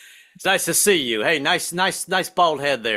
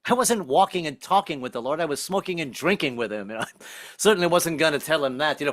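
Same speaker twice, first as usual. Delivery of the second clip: ordinary talk, nothing marked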